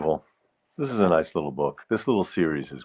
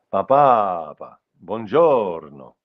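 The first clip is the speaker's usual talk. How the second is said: Italian dotted rhythm; 3 semitones higher, 6 dB louder, much slower than usual. Shown as a demonstration. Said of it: "Italian is a dotted rhythm".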